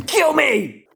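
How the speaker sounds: high loud despairing yell; shouting. Real, not acted.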